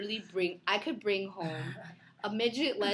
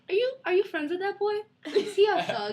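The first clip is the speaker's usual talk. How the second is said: high-pitched, louder mock mom; mom impression; 10 semitones higher, 5 dB louder than usual. Mom asking about a friend not in a suit, mock-suspicious. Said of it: "she'll be like".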